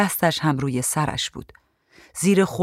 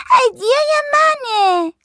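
put-on piercingly high, drawn-out kid's yell; screamed in a stretched-out small child voice; shrieking child; 5 dB louder, much slower than usual. A narrator's reading. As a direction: squeal it loudly, claiming what is yours. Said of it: "جیغ کشان داد زد".

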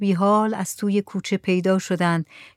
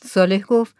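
ordinary delivery, nothing marked

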